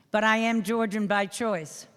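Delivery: ordinary talk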